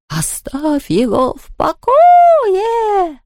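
high, very slow ghostly cry; wailing yell. A narrator's reading. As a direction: howl the last word upward. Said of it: "внезапно завопил Хуга".

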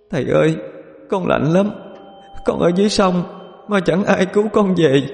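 lightly voiced crying child; sobbing child. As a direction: plead like a frightened drowned child. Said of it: "Con Khoai khóc nấc lên".

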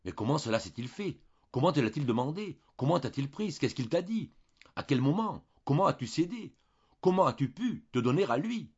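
pressing the maid with hurried, rising questions; hurried questioning. A narrator's reading. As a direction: fire the questions at her, breathless and urgent. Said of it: "interrogea d'une voix précipitée".

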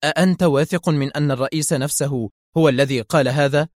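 ordinary delivery

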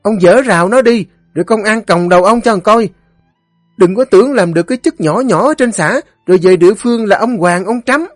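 very high, rapid nagging tirade; scolding wife. A narrator's reading. Wife scolds her drunken husband for picking fights, scolding. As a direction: berate him shrilly, barely pausing.

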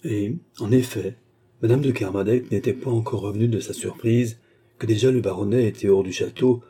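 usual speaking voice

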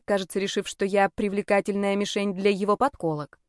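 usual speaking voice

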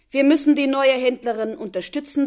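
loud, for all to hear. A narrator's reading. Worker speaking loudly so the guards hear, loud cover line. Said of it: "sagte er laut".

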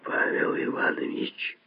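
very soft whisper, the spite gone; faint whisper, no anger. A narrator's reading. Lazovsky appealing to fellow surgeon, hushed whisper without anger. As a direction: whisper the name without any malice. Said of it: "ещё тише сказал Лазовский, теперь уже никак не злобно".

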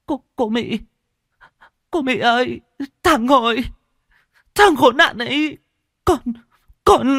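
high, broken voice bursting into tears; sobbing. Acted. Diệp wakes and sobs to Mị about Hội. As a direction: blurt it out between sobs, words breaking off. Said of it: "cô òa lên nức nở".

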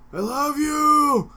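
audience yell. Retold or imitated. Fan yells love to the singer from the audience. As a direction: call it out across a concert hall. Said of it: "somebody in the audience yelled".

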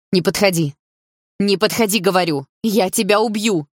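rising, urgent threat; desperate warning. A narrator's reading. Mother holding off stepfather with a gun, desperate warning. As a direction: warn him off, voice climbing.

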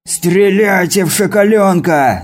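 high, slow, furious roar; yelling. A narrator's reading. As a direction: bellow the order to shoot. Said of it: "заорал Крест".

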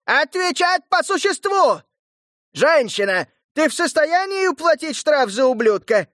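high harsh herald yelling; shrill yell. Acted. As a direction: screech at the woman. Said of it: "глашатай визгливо заорал".

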